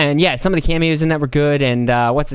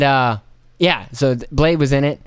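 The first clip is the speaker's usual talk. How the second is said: normal talking voice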